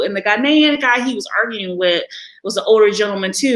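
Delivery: ordinary talk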